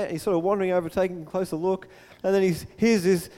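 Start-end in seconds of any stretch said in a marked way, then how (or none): none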